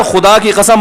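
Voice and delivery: ordinary delivery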